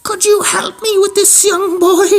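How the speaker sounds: high, slow falsetto granny voice; hammed-up old lady voice. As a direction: put on a high-pitched old woman, drawn out. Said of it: "there was an old lady".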